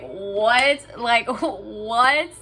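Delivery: can't believe it, laugh it off; laughing disbelief. Real, not acted. Vlogger retelling the bank's warning, laughing in disbelief.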